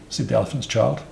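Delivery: plain reading voice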